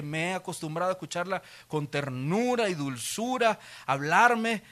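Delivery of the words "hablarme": ordinary talk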